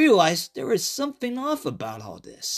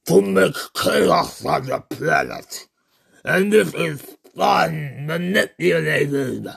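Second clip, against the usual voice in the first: slow, low gloating monster voice; gloating Cthulhu; 3 semitones lower, slower than usual. Acted. Cthulhu gloating to the fooled savior, slow and low. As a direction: savor the betrayal, sinking to a low finish.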